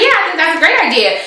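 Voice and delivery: high, quick imitation of an eager guest; eager agreement. Guest welcomes the breakfast plan, eager agreement.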